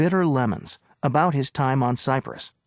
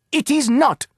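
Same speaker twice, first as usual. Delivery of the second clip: higher, louder insistence; shouted retort; 11 semitones higher, 5 dB louder than usual. Acted. Agent insists to the owner, shouted retort. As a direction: fire it straight back. Bargaining quarrel over key.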